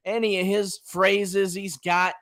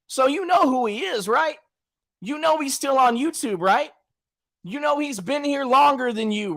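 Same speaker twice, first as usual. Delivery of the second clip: normal talking voice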